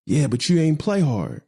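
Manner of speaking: mildly heated retold dressing-down; retold upset scolding. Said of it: "And I'm getting upset".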